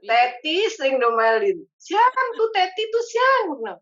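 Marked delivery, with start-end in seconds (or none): 1.8-3.5 high, slow nagging mom voice; a mother impatiently calling out, hammed up; mimicking Mama. Mama scolding Teti, quoted.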